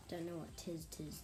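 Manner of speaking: plain reading voice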